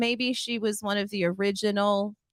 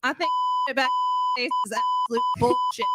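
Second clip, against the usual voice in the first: chipmunk voice effect. Pretend. Voice effect bleeps the swear.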